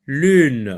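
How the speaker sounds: loud, stretched "lune" with the n held; drawn-out loud lune. Shown as a demonstration. Teacher modelling "lune" loudly for children. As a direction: sing out "lune" so the final n rings. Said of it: "Écoutez bien ce mot lune".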